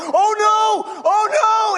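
high loud mock panic shout; panicked shouting. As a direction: yell it like you've seen something awful. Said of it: "and I went".

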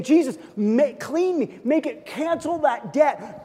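rising, pleading cry to Jesus; desperate plea, slowing at the end; pleading prayer. Acted. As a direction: beg for cleansing, voice climbing.

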